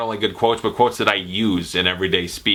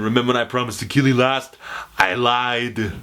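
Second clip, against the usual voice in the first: Arnold Schwarzenegger. Retold or imitated. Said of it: "Arnold Schwarzenegger".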